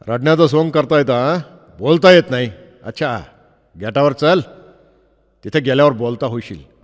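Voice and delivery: loud, mocking cop shouting; sneering policeman. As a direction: taunt the crying boy loudly. Policeman mocks the crying boy.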